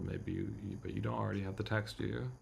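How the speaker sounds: ordinary talk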